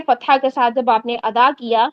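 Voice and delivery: ordinary talk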